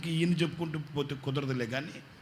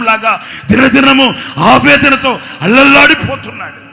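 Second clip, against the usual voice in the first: much higher, much louder shouted sermon; fiery preaching; 10 semitones higher, 18 dB louder than usual. Acted. Pastor shouting the family's suffering to the congregation. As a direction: thunder it from the pulpit.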